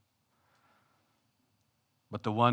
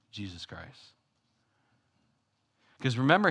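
normal talking voice

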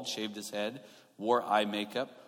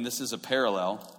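normal talking voice

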